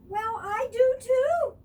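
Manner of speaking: high, loud little fawn voice; Baby Deer. A narrator's reading. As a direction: sound small and proud.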